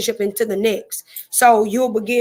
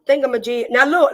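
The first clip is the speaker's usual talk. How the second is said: normal talking voice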